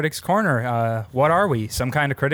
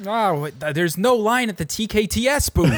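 excited tourist; 8 semitones higher than usual. Pretend. Voicing the flying lions as tourists.

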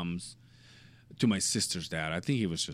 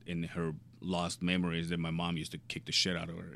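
ordinary delivery

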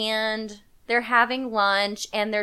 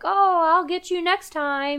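lightly voiced dad, higher pitched; playful, raised-pitch quote of a father; mock good-sport dad; 7 semitones higher than usual. Dad answering son after losing a race, playful mock threat. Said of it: "the dad's like".

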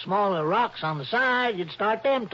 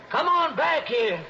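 higher, called out down the canyon; calling down; 9 semitones higher, 3 dB louder than usual. Acted. Shorty calling down to Dollar, anxious call. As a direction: call him back up from below.

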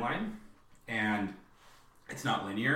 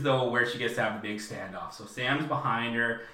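ordinary talk, nothing marked

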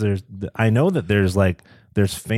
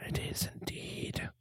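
whisper; 19 dB quieter than usual. Real, not acted. Whispered aside.

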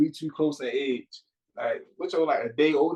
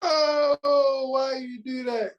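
anguished cry; 7 semitones higher, much slower than usual. Real, not acted. Anguished at a tough choice.

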